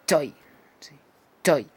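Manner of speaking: English diphthong OI; open O to I. Shown as a demonstration. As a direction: toy with open O gliding to I. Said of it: "O abierta con una I al final".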